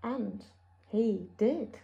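low, very soft storytelling; hushed narration. A narrator's reading. Narrator after the wolf's attack, low and hushed. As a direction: drop to a grave hush.

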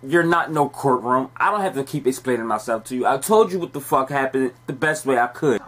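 ordinary talk

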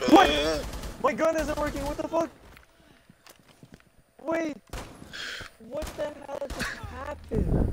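squeaky high outraged voice; high-pitched disbelief. Real, not acted. Gun jams, outraged disbelief.

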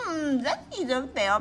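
usual speaking voice

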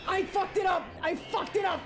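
high, loud panicked yelling; frantic high-pitched outburst; freaking out. Real, not acted. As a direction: yell in a panic as you botch the game. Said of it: "I'm freaking out".

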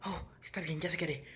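panicked whispered inner thoughts; nervous whisper. Pretend.

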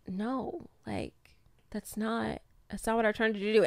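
imitating his soft protest; quiet, sheepish denial; soft-spoken. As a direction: say it softly, hurt and defensive. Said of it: "I don't care that you're soft-spoken".

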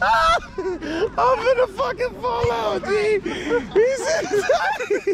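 high, wheezy words squeezed out between laughs; helpless laughter. Real, not acted. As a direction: say it while falling out laughing.

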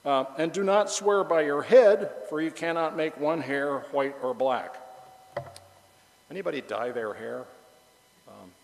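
usual speaking voice

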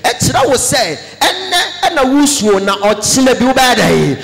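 loud, fervent prophetic declaration; fiery sermon climax; preaching at full force. Acted. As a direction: proclaim the blessing over the congregation.

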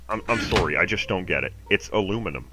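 usual speaking voice

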